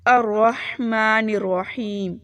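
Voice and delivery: slow drawn-out recitation of الرحمن الرحیم; Quran recitation. Shown as a demonstration. Teacher reciting الرحمن الرحیم for students. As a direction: recite it slowly, melodically. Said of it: "تجوید tip".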